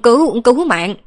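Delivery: high, loud cry in a changed voice; panicked cry for help. A narrator's reading. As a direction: yelp for help as the voice jumps up. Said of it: "Âm thanh của Tả Tiểu Đa cũng đổi cả giọng luôn". (giọng lạc đi, kêu cứu cao và to)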